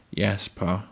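ordinary delivery, nothing marked

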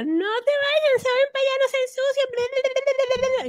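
high mock nagging mom voice. Mocking mom nagging kids about dirty clothes, high nagging mimic. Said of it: "mami decía".

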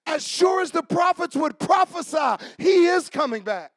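loud, high preacher's shout over music; full-force preaching. Acted. Preacher shouting to the congregation, loud and high. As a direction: preach it out with fire.

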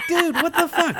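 high squeal of disbelief; stunned. Retold or imitated. Dylan seeing Greg walk in, high shocked squeal. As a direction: shriek it in total shock.

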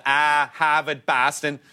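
slow, drawn-out mock Boston accent; imitating Boston accent. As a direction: stretch it out like a tourist doing Boston. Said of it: "a lot of people do the".

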